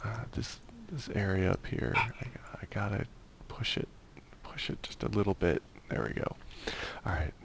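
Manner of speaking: very soft, half-whispered self-talk; murmuring to oneself. Real, not acted. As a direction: murmur to yourself, absorbed in the work. Painter murmuring to himself while working.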